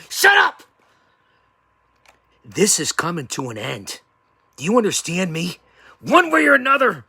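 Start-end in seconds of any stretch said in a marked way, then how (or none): 0.1-0.6 sudden high yell to shut him up; a furious wrestling-promo outburst; angry shout. Acted. Heated promo at rival. 6.0-7.0 threat. Acted. Threatening rival in promo.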